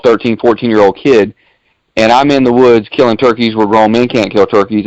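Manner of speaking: ordinary talk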